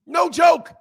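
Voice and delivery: high loud insistence; loud emphatic no joke; shouted no joke. Real, not acted. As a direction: shout it so they believe you. Insisting the story is true.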